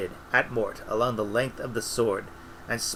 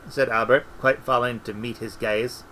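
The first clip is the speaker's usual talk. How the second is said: ordinary delivery, nothing marked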